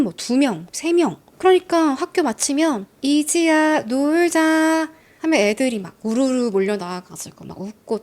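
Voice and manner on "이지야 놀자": drawn-out sing-song call to come play; calling a friend out. Retold or imitated. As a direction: stretch 놀자 slowly like a kid at the door.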